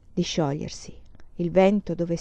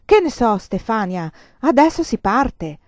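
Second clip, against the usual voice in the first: irritated snap; 2 semitones higher, 6 dB louder than usual. Acted. Mother snaps at her daughter Stefania, irritated and louder. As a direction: brush the child off with sharp annoyance. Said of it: "Le rispose stizzita".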